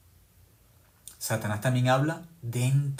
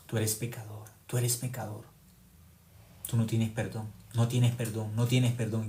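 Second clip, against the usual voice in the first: Satan's voice; 2 semitones lower, 6 dB quieter than usual. Retold or imitated. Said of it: "Satanás le hablaba más fuerte".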